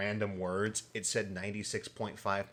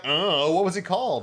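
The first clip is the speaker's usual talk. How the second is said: mocking impression; 6 semitones higher, 7 dB louder than usual. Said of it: "Don't look at me like".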